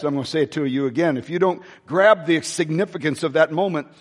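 normal talking voice

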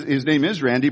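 usual speaking voice

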